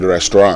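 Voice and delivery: "restaurant" with a silent final T; French-style pronunciation. Shown as a demonstration. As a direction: end "restaurant" on an open nasal vowel, French style. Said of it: "the original word is French".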